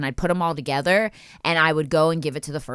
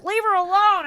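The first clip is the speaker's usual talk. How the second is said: high mock shout; shouting bystanders; 13 semitones higher than usual. Office workers yelling at the chaser, high. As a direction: yell as disapproving business people. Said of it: "they're like".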